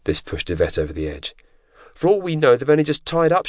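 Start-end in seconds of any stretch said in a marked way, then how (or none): 2.0-3.5 high, loud exasperated outburst; raised-voice protest; screamed. A narrator's reading. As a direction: shout it high, at the end of your patience. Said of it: "she screamed".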